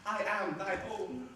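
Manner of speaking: a hint of a baby speaking to its father; voicing the baby. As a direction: lightly voice the child's fond look. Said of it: "as who should say".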